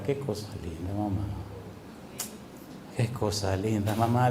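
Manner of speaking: almost breathe it, choked with emotion; tender awe. Real, not acted. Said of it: "emotional soft exclamation". (muy suave y grave, emocionado)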